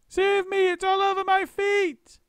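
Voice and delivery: piercing high shriek; terrified wife shrieking; scream. A narrator's reading. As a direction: scream for your life. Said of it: "screamed Missus Twit".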